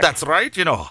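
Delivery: put-on high, playful Scottish accent; Scotty. Host playing along with guest's joke, Scottish accent. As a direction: answer in a mock Scottish brogue. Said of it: "you could have played Scotty".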